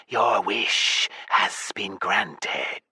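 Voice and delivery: very slow, hushed serpent hiss. Acted. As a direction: hiss it like a giant snake. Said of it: "Apophis hissed".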